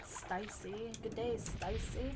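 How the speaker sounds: mock Aussie "Stacy", said as "Sty-see"; playful Australian greeting, "Stacy" as "Sty-see"; Australian accent. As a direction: put on an Aussie "Good day, Stacy". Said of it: "with, like, an Australian accent".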